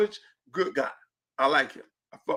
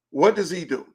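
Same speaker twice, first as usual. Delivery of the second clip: normal talking voice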